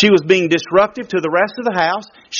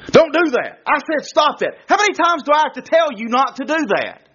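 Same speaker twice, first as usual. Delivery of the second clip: mock scolding parent; 6 semitones higher than usual. Parent scolding child, mocked.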